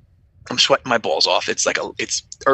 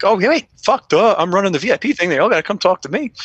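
excited realization; 7 semitones higher than usual. Retold or imitated. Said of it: "So I'm like".

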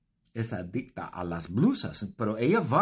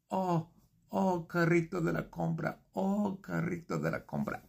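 higher, swooning over the shopping cart; lovesick sighing; 7 semitones higher, 3 dB quieter than usual. Retold or imitated. Lovesick man swooning over his shopping cart, softly higher. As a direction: pine for the cart, lightly. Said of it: "Mira, yo estoy".

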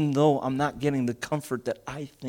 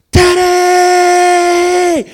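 put-on much higher, loud anguished cry of a girl; child's scream; 14 dB louder than usual. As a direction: scream for daddy in pain. Daughter cries out at needle.

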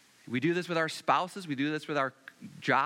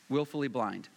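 ordinary talk

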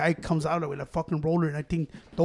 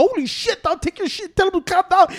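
surprised exclamation; 12 semitones higher, 4 dB louder than usual. Retold or imitated. Pound worker startled by dog.

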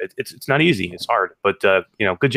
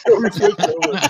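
laughing; 14 semitones higher than usual. Real, not acted. Laughing after the story.

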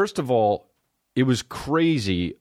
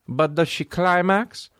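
put-on Italian accent; 10 semitones higher, slower than usual. Italian voice in quoted dialogue.

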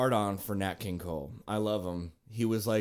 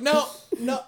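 pleading; 12 semitones higher than usual. Retold or imitated. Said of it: "And I was like".

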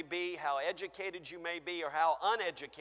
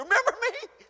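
higher, louder, half-laughing demand; insistent; 10 dB louder than usual. Acted. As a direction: push God harder this time. Acted plea, louder take.

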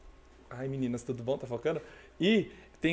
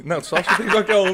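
laughing; 5 semitones higher, 9 dB louder than usual. Real, not acted. Laughing at the guess Tambaqui.

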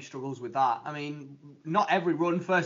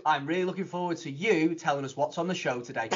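ordinary talk

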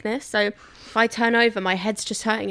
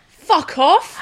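loud, higher Essex yell of fuck off; Essex shout; 7 semitones higher, 7 dB louder than usual. Shown as a demonstration. Showing friends how to say it in an Essex accent, shouted. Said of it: "you got to go like this".

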